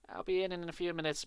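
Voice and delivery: ordinary delivery, nothing marked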